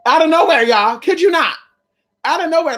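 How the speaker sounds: high and loud, full of wonder; amazed exclaiming. Real, not acted. Telling the viewers about the angel, amazed. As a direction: insist it really happened out of nowhere.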